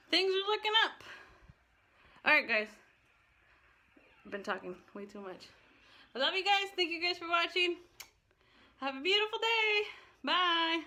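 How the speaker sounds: bright, high, happy goodbye; cheerful sign-off. Real, not acted. Upbeat sign-off to viewers.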